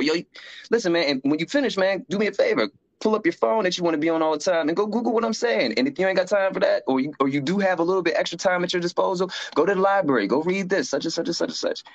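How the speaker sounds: pitched well up, pressing his point; a lightly voiced younger self, insistent; urging mentor. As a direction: push them to go look it up. Retells urging fellow inmates.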